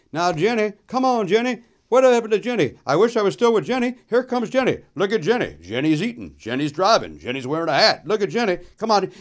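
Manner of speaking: mock the movie by calling Jenny over and over; a hint of Tom Hanks as Gump; Forrest Gump impression. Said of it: "Forrest Gump, played by Tom Hanks, starts saying".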